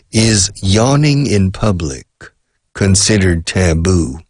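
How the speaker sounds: slow, deliberate question, split into phrases. Shown as a demonstration. Teacher repeats the question for struggling learners, slowed down. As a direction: take the question slowly, phrase by phrase. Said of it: "I'll slow down a little bit".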